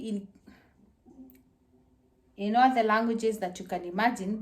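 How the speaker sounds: ordinary delivery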